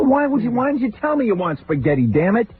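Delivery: high, exasperated yelp; scolding father-in-law, high mock outrage. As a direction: squeal it at him, fed up. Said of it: "I go".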